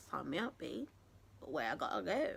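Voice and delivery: low, quiet, cool-customer aside; mock-cool acceptance. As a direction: drop it low like agreeing to a shady deal. Acts out eagerly accepting the job.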